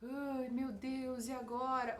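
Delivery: mock "oh dear" voice, drawn out very slowly; worried lament. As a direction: imitate a helpless, drawn-out lament. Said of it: "falando com aquela voz assim de".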